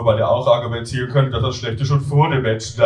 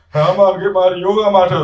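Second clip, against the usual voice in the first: high, loud call to a flatmate; Tim calling out; 7 semitones higher, 7 dB louder than usual. Acted. Student calling to flatmate for the mat, raised voice. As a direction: raise pitch and volume to get his attention.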